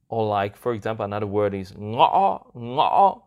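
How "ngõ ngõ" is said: "ngõ" loud, cut in the middle, rising; broken rising tone. Shown as a demonstration. Host giving another example word, "ngõ" hacked and rising. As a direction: catch the throat mid-"ngõ" and lift it. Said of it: "hacking, basically".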